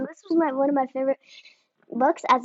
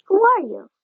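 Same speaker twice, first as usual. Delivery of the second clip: high, louder demand; loud challenge; 7 semitones higher, 6 dB louder than usual. Acted. Small chihuahua challenging the stranger, loud and high. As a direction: bark the question at the stranger.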